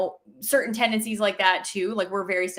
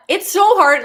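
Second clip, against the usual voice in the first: much louder, higher burst of amused talk; louder and higher, half laughing; amused exclaim; 9 semitones higher, 10 dB louder than usual. Real, not acted. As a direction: blurt it out still laughing. After laughing at story.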